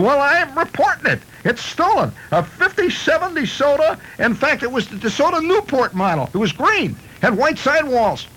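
high, whiny complaining voice; indignant car owner. Acted. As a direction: report the theft in a fuss. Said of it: "He said".

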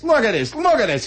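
high, indignant mock complaint; appealing to crowd. Slob turns to the crowd behind him, indignant appeal. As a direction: play the outraged customer rallying onlookers. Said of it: "customer that appeals to the crowd".

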